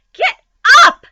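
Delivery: very high, loud scream. Acted. Wilbur screaming at Templeton, very high and loud. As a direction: scream at the lazy rat. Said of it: "screamed Wilbur".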